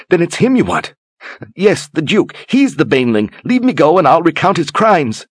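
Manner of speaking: high, frantic bargaining; desperate. Acted. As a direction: blurt it out in fear.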